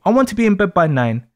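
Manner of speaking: usual speaking voice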